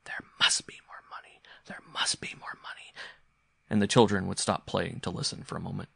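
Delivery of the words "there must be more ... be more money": eerie, voiceless whisper. A narrator's reading. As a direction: whisper it softly like a voice behind the toys. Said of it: "a voice would start whispering".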